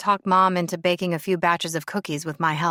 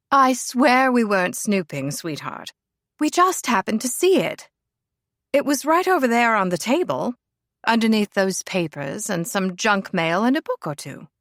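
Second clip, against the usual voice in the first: posh lady; 3 semitones higher than usual. Acted. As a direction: protest your innocence sweetly. Lady Helena excuses herself.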